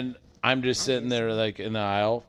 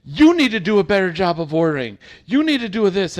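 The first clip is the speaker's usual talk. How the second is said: louder, higher scolding at the clerk; imitated yelling; 7 semitones higher, 10 dB louder than usual. Angry customer yelling at the employee, loud and high. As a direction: lecture him loudly about ordering. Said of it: "starts yelling at me".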